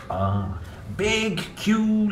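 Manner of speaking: ordinary delivery, nothing marked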